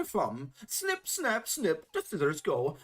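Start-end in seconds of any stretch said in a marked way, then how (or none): none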